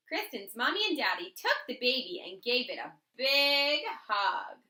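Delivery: plain reading voice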